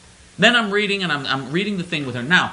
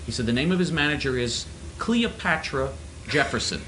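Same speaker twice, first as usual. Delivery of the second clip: ordinary delivery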